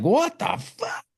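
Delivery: very high, drawn-out mock disbelief; slow squeal of put-on shock; imitated shock. Retelling her shock after the attack, high mock disbelief.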